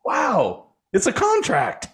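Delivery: high-pitched retold wow; amazed realization. Acts out own amazed realization.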